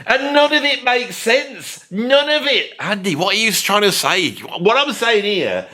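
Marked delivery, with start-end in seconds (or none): 0.1-2.7 high, drawn-out exasperation; exasperated. Real, not acted. Reviewer ranting that the song makes no sense, exasperated. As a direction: stretch it out, baffled and fed up.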